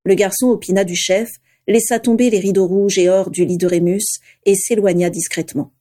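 usual speaking voice

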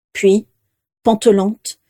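ordinary delivery

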